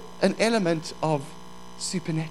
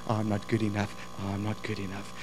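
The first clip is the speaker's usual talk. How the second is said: recording of self-doubt; 9 semitones lower, 9 dB quieter, faster than usual. Acted. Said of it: "Don't believe the recording that's playing".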